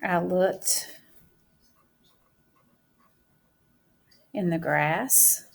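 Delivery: drawn out, word by word as she writes; teacher dictating her sentence while writing; slow dictation. Shown as a demonstration. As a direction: say it at writing speed for the class.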